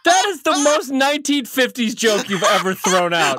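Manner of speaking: high, laughing mock outrage; laughing disbelief. Real, not acted. Co-host reacting to an old-fashioned joke, laughing in disbelief. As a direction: scold the corny joke through laughter. Mocks co-host's corny joke.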